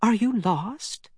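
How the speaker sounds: a hint of an old lady's hush, slow and high; slow, hushed and high, like a kindly granny; lightly whispered old lady. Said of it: "and whispered".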